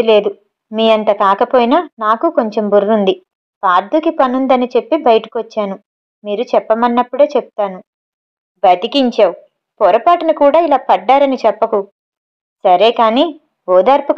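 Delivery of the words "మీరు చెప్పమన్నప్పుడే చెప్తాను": plain reading voice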